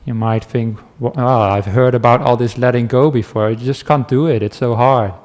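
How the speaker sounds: ordinary talk, nothing marked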